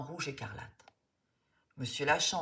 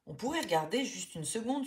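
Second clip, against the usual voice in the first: pleading; 7 semitones higher than usual. A narrator's reading. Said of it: "supplie Léna".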